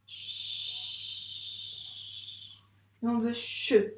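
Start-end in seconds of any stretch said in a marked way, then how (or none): 0.1-2.6 ch soufflé seul, très doux; isolated sound. Shown as a demonstration. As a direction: faire entendre le ch seul. (son ch soufflé tout doucement)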